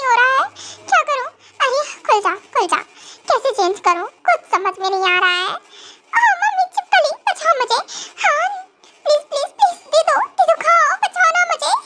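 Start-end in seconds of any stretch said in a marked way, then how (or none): 6.2-11.7 high panicked cries for help; scared yelling. Acted. Bride yelling in fear from the washroom. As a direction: squeal for mummy at the lizard. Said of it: "तुम क्या चिल्ला रही हो?".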